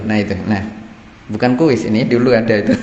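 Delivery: ordinary delivery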